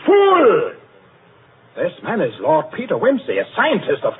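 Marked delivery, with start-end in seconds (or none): 0.0-0.8 sharp, much higher, loud outburst of scorn; contemptuous shout. Acted. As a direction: bite his head off with one word. Angry chairman snaps at member.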